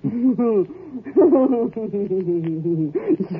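a high, delighted laugh at the news; cackling with satisfaction; gleeful laugh. Acted. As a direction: laugh with spiteful glee. Wife laughs at poisoned dog.

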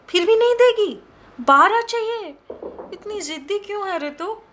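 loud haggling outburst at the vendor; outraged haggling. Acted. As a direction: shout down her price. Haggling with the pot-seller.

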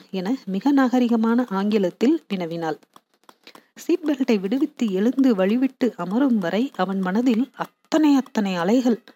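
ordinary delivery, nothing marked

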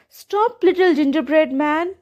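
high calling after a runaway. Acted. Old woman calling after the fleeing gingerbread man, high call. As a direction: call him back from across the room, pitched high. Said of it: "she called".

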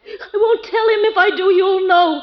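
high, tearful defiance; crying protest, building to an outburst; hysterical refusal. Acted. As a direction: refuse in mounting panic. Terrified wife refusing to answer.

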